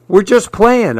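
high, yelled reply across the yard; yelled back. A narrator's reading. Jack answering Betsy on the landing, yelling back. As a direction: call back to her, defensive. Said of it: "I yelled back".

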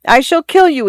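high desperate threat; crying out. A narrator's reading. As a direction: threaten him, voice climbing. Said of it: "he cried out".

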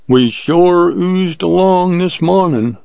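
slow, high-pitched cowboy-style drawl. A narrator's reading. Jim to his fellow hunters after the chase, drawled. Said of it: "drawled Jim".

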